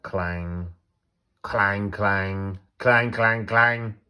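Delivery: mimicking a bell rung harder and harder; bell clangs. A narrator's reading. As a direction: ring out each clang, growing. Boy ringing the nervous bell.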